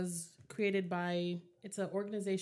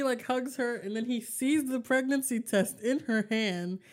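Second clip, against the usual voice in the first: ordinary delivery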